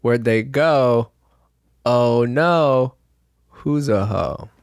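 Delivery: ordinary delivery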